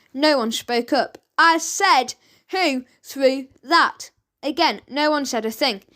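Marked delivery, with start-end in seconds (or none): 1.4-4.1 "who threw that" slowed right down; slow insistent demand. A narrator's reading. Judge repeating the question to a silent court, slowly. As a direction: spell it out, losing patience.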